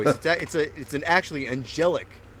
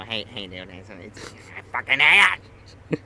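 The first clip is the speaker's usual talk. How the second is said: lightly put-on Vince voice; a mock of Vince griping about the voice; doing the voice; 5 semitones lower than usual. Friend mocking how Vince complains, lightly voiced. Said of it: "when you go, when you go".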